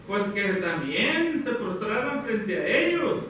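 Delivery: ordinary talk, nothing marked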